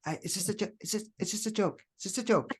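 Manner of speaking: a meek little voice; imitating wife's protest. Wife handing over a gift, sheepish and mock-small. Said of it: "she's like".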